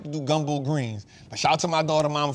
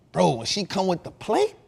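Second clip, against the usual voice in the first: ordinary talk, nothing marked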